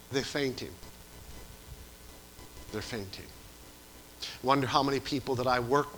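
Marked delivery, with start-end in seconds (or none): 2.7-3.3 softly echoed. Acted. Repeated softer for effect.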